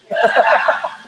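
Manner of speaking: short high laugh. Real, not acted. Preacher reacting to the germ joke, laughing. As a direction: burst of high-pitched laughter.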